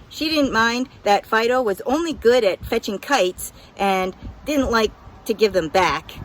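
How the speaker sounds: ordinary delivery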